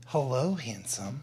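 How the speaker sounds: femme fatale. Acted. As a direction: purr it slowly like a seductress. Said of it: "play the role of Olivia".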